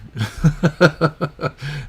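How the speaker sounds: high, loud burst of laughter; teasing laugh. Real, not acted. Streamer teasing a viewer in chat, real laughing. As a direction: laugh hard at your own teasing.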